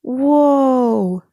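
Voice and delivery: high, loud whoop while flying; scream. A narrator's reading. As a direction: yell mid-air in a stunt. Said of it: "Mater screamed".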